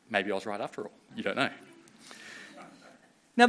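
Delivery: normal talking voice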